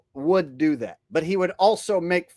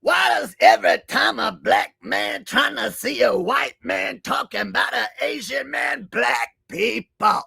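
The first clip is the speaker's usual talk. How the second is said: Chris Rock; 4 semitones higher than usual. Acted. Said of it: "Haven't done Chris Rock in a while".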